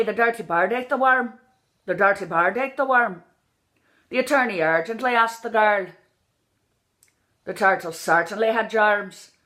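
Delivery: Northern Irish upward lilt, "dirty bird" as "darty bard"; Northern Irish accent. Shown as a demonstration. Coach demonstrating inflection, Northern Irish lilt. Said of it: "It mostly goes up".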